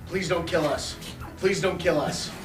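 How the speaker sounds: ordinary talk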